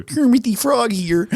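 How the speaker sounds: lightly voiced frog puppet; Kermit the Frog. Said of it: "I would prefer Kermit".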